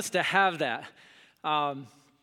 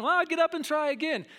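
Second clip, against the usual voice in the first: mom's saying; 10 semitones higher than usual. Retold or imitated. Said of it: "That's what she says".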